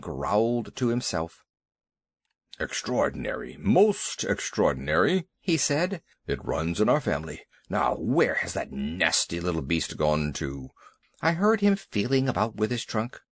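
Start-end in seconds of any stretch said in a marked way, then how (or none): 2.6-10.7 deep, ponderous elephant. A narrator's reading. Elephant voiced by narrator.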